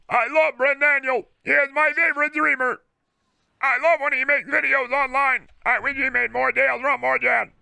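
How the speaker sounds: flat synthetic computer read of a fan message; squeaky text-to-speech chatter; old AI voice synthesizer. Pretend. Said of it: "like an old A I voice synthesizer".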